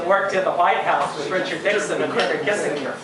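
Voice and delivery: ordinary talk, nothing marked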